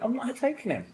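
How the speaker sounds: firm mum. Retold or imitated. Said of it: "Like that, she went".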